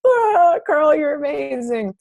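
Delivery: high, loud emotional cry of praise; crying out in awe at the speaker; overwhelmed gush. Retold or imitated. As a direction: burst out tearfully praising Carl. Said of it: "I lost my shit".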